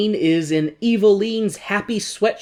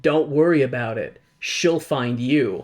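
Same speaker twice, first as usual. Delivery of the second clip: ominous guard; 4 semitones lower than usual. Retold or imitated. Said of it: "the guard says".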